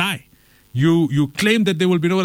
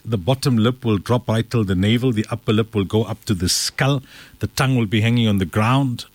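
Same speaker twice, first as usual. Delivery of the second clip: usual speaking voice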